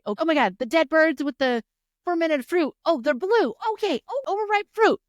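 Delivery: high, breathless-sounding rush of discovery; acted eureka moment; excited realization. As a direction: pieces clicking together, pitched right up. Acting out a sudden realization.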